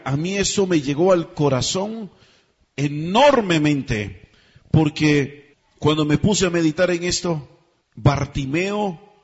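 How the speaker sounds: ordinary delivery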